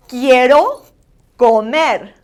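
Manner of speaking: loud "Quiero" rising, pause, "comer" falling; teacher's clear "Quiero, comer", vowels full; accentuated Spanish vowels. Shown as a demonstration. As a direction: model "Quiero comer" word by word. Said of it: "vowels are very important that you accentuate".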